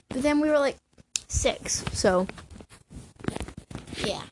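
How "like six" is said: ordinary talk, nothing marked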